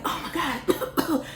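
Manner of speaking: high, slow, breathless panic; panicked gasp. Retold or imitated. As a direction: gasp it out like you're choking. Choking after smoking the blunt.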